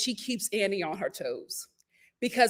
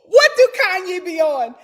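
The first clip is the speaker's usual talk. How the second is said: laughing shout; 13 semitones higher, 8 dB louder, slower than usual. Real, not acted.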